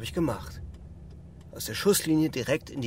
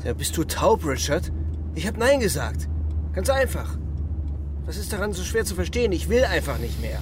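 angry outburst; 6 semitones higher than usual. Acted. Refusing Richard's mission again, angry and fed up. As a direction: snap at him, fed up.

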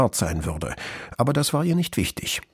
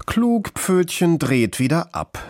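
lightly teasing, drawn-out opening; affectionate gentle jibe; good-natured mockery; 7 semitones higher than usual. A narrator's reading. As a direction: tease a sibling fondly. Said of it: "der etwas spöttische und zugleich gutmütige Kommentar".

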